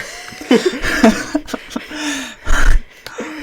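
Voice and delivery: long, loud, high laughing fit; real burst of laughter. Real, not acted. Flatmate forgot his passport for India, loud helpless laughter. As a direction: crack up loudly and keep going.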